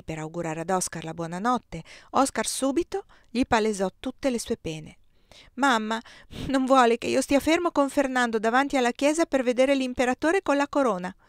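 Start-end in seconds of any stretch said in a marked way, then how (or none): 5.5-11.1 put-on higher, louder child voice; a boy airing his woes; child complaining to father; complaining little boy. A narrator's reading.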